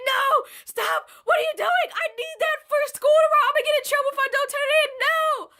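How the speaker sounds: high, loud, tearful pleading shout; panicked child's crying yell. Retold or imitated. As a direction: freak out as a kid losing her homework. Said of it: "I started freaking out immediately".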